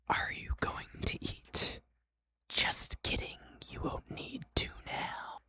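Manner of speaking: slow, taunting whisper; very hushed, drawn-out whisper; whispered game voice. Acted. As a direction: whisper it slowly as a teasing game. Game talks again, whispered.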